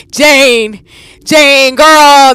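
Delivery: loud high panicked calls; frantic shouting of a name; calling out. Real, not acted. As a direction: yell her name to snap her out.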